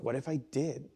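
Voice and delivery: hushed, frightened self-question; nervous witness asking himself, hushed and afraid; scared thought. Retold or imitated. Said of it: "scared at that time".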